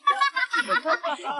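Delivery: high-pitched scornful laughter; mocking laugh. Rival laughing at the amateurs' scheme, mocking laugh. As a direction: laugh at their amateur trick. Mocks the enemy's failed trap.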